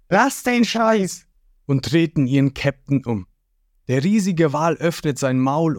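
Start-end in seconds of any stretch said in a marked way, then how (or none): 0.1-1.3 high, slow exasperated cartoon yell; yelling crewmates. Lysop and Sanji stopping their captain, exasperated yell. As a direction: snap at the captain to stop. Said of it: "Lysop und Sanji brüllen".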